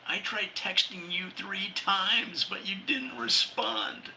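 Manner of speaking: mock message voice. Reading a silly alien message.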